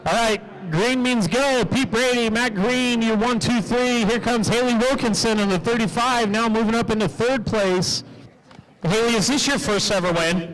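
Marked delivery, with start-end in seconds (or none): 0.0-8.0 steady, rolling track-announcer call; live race play-by-play. Real, not acted. Track announcer calling the start and leaders, steady play-by-play. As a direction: rattle off the running order for the crowd.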